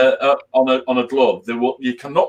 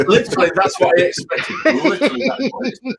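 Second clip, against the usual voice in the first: laughing; 7 semitones higher, faster than usual. Real, not acted.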